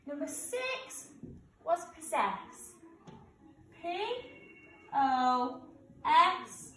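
spelling out letters one by one; slow school dictation. Acted. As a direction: spell each letter clearly for children. Teacher spells out the answers.

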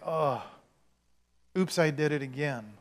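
soft, understated oops; mock mild regret. What Peter didn't just say, mock mild regret. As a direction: toss off a quiet, token regret. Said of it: "he didn't just tear up and say".

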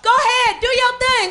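put-on very high, loud gushing girlfriend; fake congratulation. Woman congratulating a friend she talks about, fake gushing. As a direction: overdo the praise in a high voice. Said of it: "women will be like".

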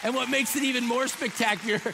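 loud, high, eager build-up; excited build. Real, not acted. Pastor to congregation, loud eager excitement. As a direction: push the reveal with delight.

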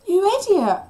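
loud, high-pitched scolding shout; furious cry. A narrator's reading. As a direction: explode at someone in angry panic. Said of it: "I cried".

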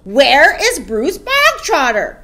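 loud, slow, commanding roar; barked demand. A narrator's reading. Headmistress hunts for a pupil in assembly, barked. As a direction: demand it of the whole hall. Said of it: "barked suddenly".

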